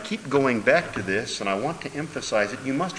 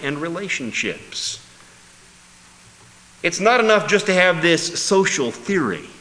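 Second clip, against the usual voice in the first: preaching at full force; 5 semitones higher, 7 dB louder than usual. Acted. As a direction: drive the call home from the pulpit.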